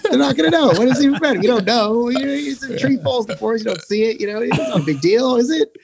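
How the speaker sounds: high squeaky justifying voice; mock sneaky voice. Guy on tour voicing his sneaky excuses, high and sly. Acting out his guilty reasoning.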